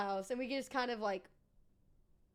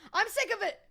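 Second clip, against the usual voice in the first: high, loud burst of mock exasperation; mock fed-up shout; 9 semitones higher, 8 dB louder than usual. Streamer ribbing a viewer over uptime, mock fed-up yell. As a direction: explode in playful outrage.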